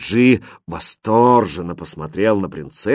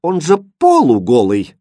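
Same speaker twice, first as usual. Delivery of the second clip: high, loud objection; breezy dismissal; 6 semitones higher, 6 dB louder than usual. A narrator's reading. Princess arguing with her governess, dismissive. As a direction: pitch it up, waving the worry away.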